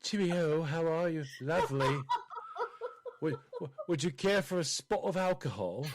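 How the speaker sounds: hammed-up British tea-party host; put-on British tea-time politeness; mock British accent. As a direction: play a very proper British host. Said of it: "Do I have to talk like that".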